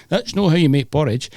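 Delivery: high, hammed-up fake Scottish; mock a bad Scottish accent from an advert; put-on Scottish, pitched well above your own voice. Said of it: "and doing fake accents".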